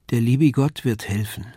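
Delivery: usual speaking voice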